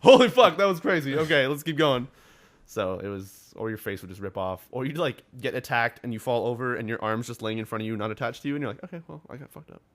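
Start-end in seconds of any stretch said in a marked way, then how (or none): 0.0-2.1 loud, high burst, then settling a little; mock amazed outburst. Player reacting to an arrow in the neck, amazed yell. Quoted reaction to sudden game death. 8.8-9.8 higher, much softer aside; mock resigned. Player reacting to a lost arm, quietly resigned.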